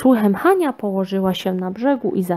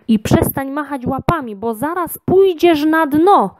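monster scolding; 7 semitones higher than usual. A narrator's reading.

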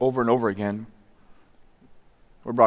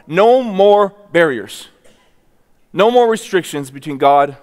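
rising sermon climax; 3 semitones higher, 5 dB louder than usual. Acted. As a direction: preach it louder and higher, building. Preaching climax of the torn curtain.

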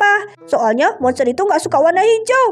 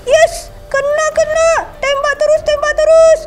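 excited battle cries; 9 semitones higher than usual. Acted. Kids urging the tank to keep firing at the monster. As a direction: yell to fire.

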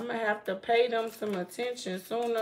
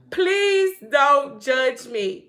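mock plea; 3 semitones higher, 9 dB louder, much slower than usual. Real, not acted.

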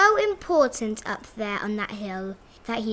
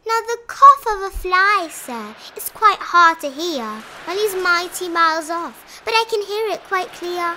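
high, raised-voice rabbit; bragging rabbit; 7 semitones higher, 8 dB louder than usual. A narrator's reading. Rabbit bragging to the bear about his hearing, high and proud. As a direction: show off the feat proudly. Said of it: "bragged the rabbit".

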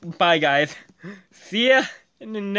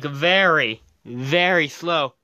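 usual speaking voice

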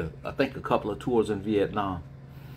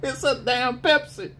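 higher drill sergeant shout through laughter; mock yell. Drill sergeant repeating the answer, laughing mock shout.

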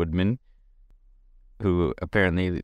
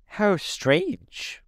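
much higher, squeaky creature voice, wondering; a puzzled beast musing aloud; Gump creature; 13 semitones higher than usual. A narrator's reading.

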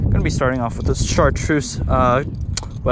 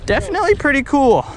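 excited; 10 semitones higher, 3 dB louder, faster than usual. Real, not acted. Fish landed.

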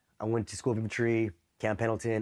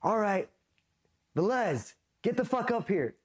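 much higher summoning yell; drill instructor summons; 10 semitones higher than usual. Retold or imitated. Drill instructor summoning a recruit, high yell. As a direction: call him up like an angry drill instructor. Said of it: "It's like".

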